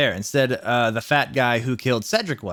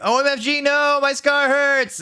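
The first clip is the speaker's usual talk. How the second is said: hammed-up scream; 12 semitones higher, 3 dB louder than usual. A narrator's reading. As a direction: scream it in mock agony. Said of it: "he started screaming".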